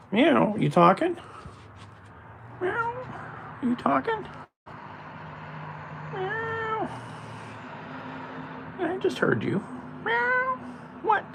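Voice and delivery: very high, soft pet-talk voice; sing-song high voice for the kitty. Real, not acted. Talks to cat in pet voice.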